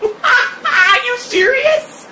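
high, laughing shout of disbelief. Real, not acted. Streamer laughing at his frozen Xbox, shouted through laughter.

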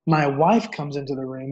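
normal talking voice

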